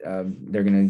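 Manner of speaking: usual speaking voice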